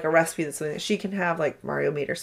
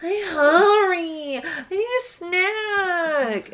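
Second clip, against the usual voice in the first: put-on high, very drawn-out toddler wail; whining hungry child; 12 semitones higher, much slower than usual. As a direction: mimic a kid begging pitifully at bedtime. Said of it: "she acts like she's gonna die".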